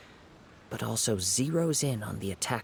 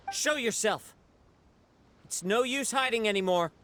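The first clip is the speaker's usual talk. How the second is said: calling out; 10 semitones higher than usual. Acted. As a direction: call them out of hiding, sharp and commanding. Calling out a hidden foe.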